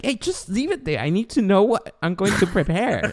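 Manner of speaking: high-pitched, pleading mock complaint; exasperated parent. Exasperated parent voiced to child.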